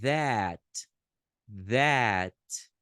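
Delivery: "that" with an open a between a and e; teacher modelling "that"; English pronunciation. Shown as a demonstration. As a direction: open the mouth wide on "that". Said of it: "É como se você falasse".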